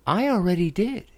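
high, dismissive shrug of a line; shrugging off. Acted. Princess brushes off the frog with a shrug. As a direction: brush him off lightly. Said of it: "she said with a shrug".

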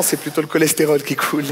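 ordinary talk, nothing marked

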